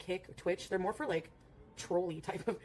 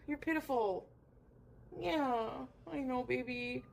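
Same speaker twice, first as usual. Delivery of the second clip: higher, pitying pet talk; cooing to cat; 4 semitones higher, much slower than usual. Real, not acted. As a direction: comfort the sorry little cat.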